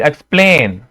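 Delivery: Explain with its ending held; stressed syllable. Shown as a demonstration. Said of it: "एन की आवाज जो है stress हो रही है".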